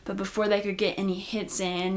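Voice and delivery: normal talking voice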